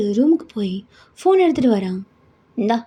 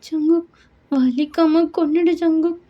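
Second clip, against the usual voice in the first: higher, slow despairing plea; daughter begging; 8 semitones higher, slower than usual. Acted. Daughter begs to be killed. (உயர்ந்த குரலில் மெதுவான கெஞ்சல்)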